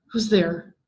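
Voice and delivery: ordinary delivery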